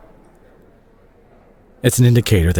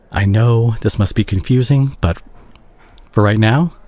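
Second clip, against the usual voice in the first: ordinary delivery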